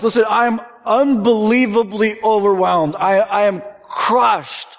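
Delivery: slow, loud, crushed; overwhelmed cry. Acted. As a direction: cry out under the weight. Paraphrasing Jesus, preaching intensity.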